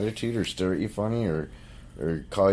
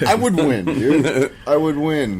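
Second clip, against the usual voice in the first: laughing, higher and louder; 5 semitones higher, 6 dB louder, slower than usual. Real, not acted. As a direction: brag through real laughter. Laughing brag about contest.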